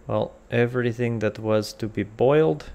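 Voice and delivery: usual speaking voice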